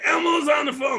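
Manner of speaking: high, loud imitation of kids; excited kids. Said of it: "they'd be like".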